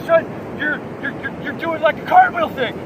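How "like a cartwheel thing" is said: normal talking voice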